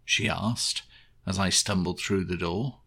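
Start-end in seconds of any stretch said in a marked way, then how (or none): none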